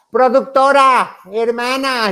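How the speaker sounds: slow shouted call to the producer; shouting. Retold or imitated. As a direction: yell across the beach to the producer. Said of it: "yo gritando".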